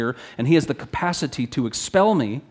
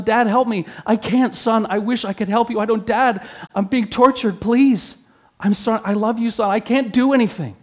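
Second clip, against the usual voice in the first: high, pleading anguished voices; anguished father and son; 10 semitones higher, 4 dB louder than usual. Acted. Son begging his father, father answering in anguish. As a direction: plead in desperation, voice strained high.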